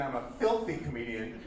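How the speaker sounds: ordinary talk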